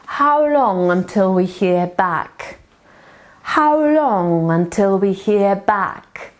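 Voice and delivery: slow, clearly spaced words; slow model sentence. Shown as a demonstration. Teacher drilling the question slowly for learners. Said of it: "Jak długo mamy czekać".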